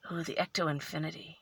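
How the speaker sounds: soft, low, quick aside; quiet musing. Real, not acted. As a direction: try the name out softly to yourself. Reading the name to herself.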